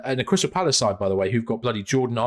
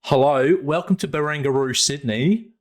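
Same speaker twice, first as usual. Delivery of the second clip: mock Australian greeting; 3 semitones higher than usual. Podcaster pretending to greet visitors, in mock Australian accent. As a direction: welcome them like an Aussie tourism ad. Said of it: "I'm gonna do this in Australian accent".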